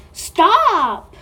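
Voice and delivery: loud yelled stop at the slime; loud startled stop; sharp shout. Real, not acted. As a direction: yell it to make it quit. Slime spilling everywhere.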